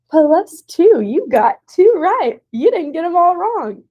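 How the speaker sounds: high, loud cheering teacher; upbeat encouragement. Retold or imitated. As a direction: celebrate a tiny success for a student. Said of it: "and I try to say".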